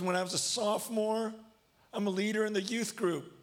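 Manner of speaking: high, strained voice on the edge of tears; retold tearful confession. Football player confessing to youth group, near bawling. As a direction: imitate a boy struggling to confess, pitched high. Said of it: "He starts bawling".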